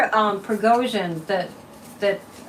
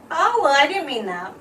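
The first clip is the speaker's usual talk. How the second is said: smirking mimic; 4 semitones higher than usual. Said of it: "he does the smirk".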